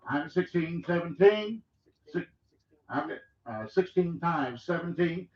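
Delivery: rapid rhythmic auction chant; auctioneer. Acted. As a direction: roll the numbers like a bid caller. Auction bid calling continues.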